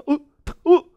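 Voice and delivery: high, loud double yelp at a burn; acted burnt-finger cry; startled yelp. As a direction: jump at the hot pan with a yelp. Replays touching the hot pan.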